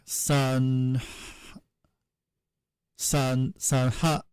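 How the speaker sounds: SANHA cut off by a glottal stop; Sanha, no k at the end; glottal-stop final. Shown as a demonstration. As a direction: sound out "San", then "Sanha" ending in a glottal catch. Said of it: "with a glow stop and not a".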